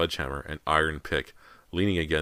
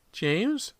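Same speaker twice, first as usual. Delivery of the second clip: high, choked cry of a frightened mother; mother choking out her son's name. A narrator's reading. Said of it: "Missus Fraser choked out".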